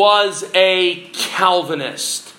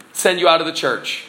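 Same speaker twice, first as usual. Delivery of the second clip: normal talking voice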